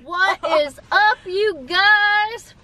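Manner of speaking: high, drawn-out hello to the camera; vlogger greeting. Real, not acted. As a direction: greet the viewers brightly, slowly. Vlog intro greeting to viewers.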